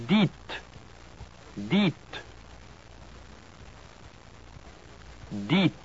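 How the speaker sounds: "Dites" as deet, pure i, final t; French pronunciation. Shown as a demonstration. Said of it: "followed by the French word twice".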